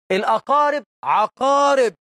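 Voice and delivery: "الأقارب عقارب" shouted slowly and loud; mocking proclamation. Preacher mocking the devilish proverb, slow loud shout. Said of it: "بيقولوا كده".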